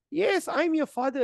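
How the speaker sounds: slow, high-pitched mock insistence; eager retold reply. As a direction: act out a father's emphatic yes. King eagerly answers his child.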